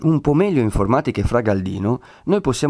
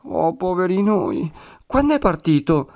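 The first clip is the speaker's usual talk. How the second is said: distressed lament; 9 semitones higher than usual. A narrator's reading. Woman hearing the friar is gone, distressed lament. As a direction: lament high like a worried woman. Agnese laments, asks when he left.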